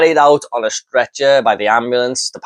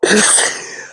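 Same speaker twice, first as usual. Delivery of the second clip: breathless laugh; 8 semitones higher than usual. Real, not acted. Laughing at belt line.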